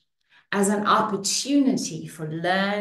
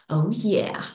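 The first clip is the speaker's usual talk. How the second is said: usual speaking voice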